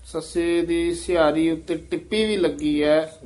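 ordinary talk, nothing marked

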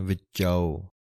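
ਵਿੱਚਾਓ said slowly, each sound clear, ending in a full "-ao"; careful pronunciation. Shown as a demonstration. Teacher showing students how to say ਵਿੱਚਾਓ, careful and clear. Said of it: "ਕਨੌੜੇ ਦੀ ਆਵਾਜ਼ ਦੇਣੀ ਹੈ".

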